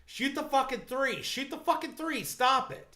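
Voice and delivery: high-pitched mock yelling at a player; retold heckler yell. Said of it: "was yelling at him like".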